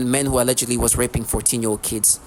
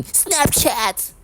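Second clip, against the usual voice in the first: mock teen yell; 10 semitones higher than usual. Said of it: "teenage trash yelling".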